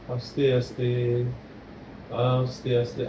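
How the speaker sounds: slow, hushed breathing cue; slow and soft. Shown as a demonstration. Therapist guiding child's breath, slow and soft. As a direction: draw the words out gently, coaching a slow breath. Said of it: "আরো slow".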